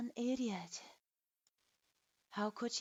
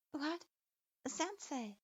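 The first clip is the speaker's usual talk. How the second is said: startled disbelief; 6 semitones higher, slower than usual. A narrator's reading. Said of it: "Narumi's eyes widened".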